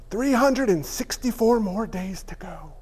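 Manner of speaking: whispered, deflated aside. Pretend. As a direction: breathe it out under your breath. Aside after Christmas ends.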